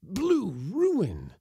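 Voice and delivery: a high, sharp cry; cry of alarm. Acted. Bacteriologist crying out in alarm at his loss. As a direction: cry out in sudden dismay. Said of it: "cried the bacteriologist".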